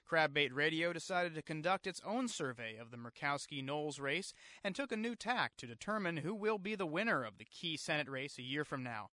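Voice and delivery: usual speaking voice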